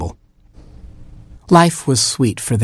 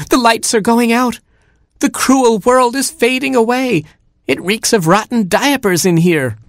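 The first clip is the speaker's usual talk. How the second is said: very high, swooning squeal; hammed-up dying speech in a tiny voice; melodramatic Gerbil; 13 semitones higher than usual. A narrator's reading. Gerbil overcome by the stink, melodramatic. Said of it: "who tended to be dramatic".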